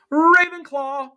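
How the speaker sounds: high, slow ringing announcement; proud proclamation of the house; hat shouting. Acted. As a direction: call the house out to the hall.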